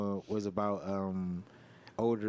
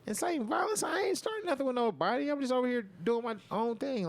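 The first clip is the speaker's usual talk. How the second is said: higher, indignant disbelief at the accusation; incredulous retort; 11 semitones higher than usual. Retold or imitated. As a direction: squeak it out in outraged disbelief. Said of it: "I'm like".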